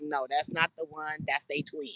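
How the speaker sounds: low, soft correction, said under the breath; softly setting a stranger straight about the twin; quiet correction. Retold or imitated. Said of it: "I was like".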